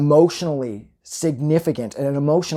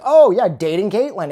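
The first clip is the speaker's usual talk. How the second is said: sarcastic taunt; 6 semitones higher, 6 dB louder than usual. Retold or imitated. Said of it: "He's like".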